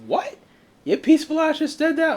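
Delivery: high, incredulous squeak of shock; can't believe the gentle giant said that; stunned disbelief. Retold or imitated. To friend Willie, retelling his shocked reaction, in high disbelief. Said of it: "I had to look at him crazy".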